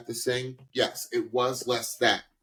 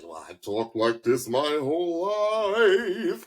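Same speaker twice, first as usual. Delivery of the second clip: slow, higher-pitched mock New York accent; overdone New York accent; 5 semitones higher, slower than usual. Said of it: "talking like you're from, you know, a borough in New York".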